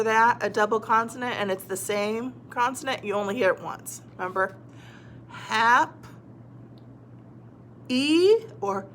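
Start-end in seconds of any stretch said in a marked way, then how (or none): 5.3-8.4 HAP, pause, then a long E; sounding out. Shown as a demonstration. Teacher testing an E ending, sounded out in parts. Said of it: "What about".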